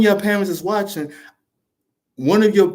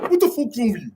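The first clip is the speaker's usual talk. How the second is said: put-on high-pitched West African accent, annoyed; 7 semitones higher, faster than usual. Father rebuking his son on the phone, annoyed West African accent. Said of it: "He pulls out his African accent".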